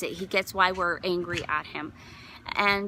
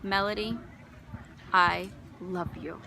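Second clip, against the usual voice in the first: God's loving voice; 3 semitones lower, 7 dB quieter than usual. Acted. God answering a grieving mother, soft and loving. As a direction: gently let each word settle, hushed. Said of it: "You know what I heard?".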